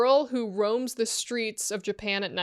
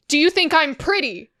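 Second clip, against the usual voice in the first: louder, higher acted question; pushy demand, pitched up and punched; insistent repeat; 6 semitones higher, 6 dB louder than usual. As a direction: press the question harder, falling at the end. Ghost repeats question, more insistent.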